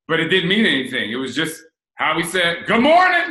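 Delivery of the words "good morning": higher, louder mock shout of good morning; loud family greeting. Imitating her loud family's everyday greeting, a playful shout. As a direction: belt the greeting like it's normal. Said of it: "the way we spoke around my family was really loud".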